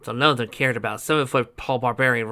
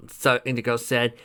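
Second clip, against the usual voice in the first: ordinary delivery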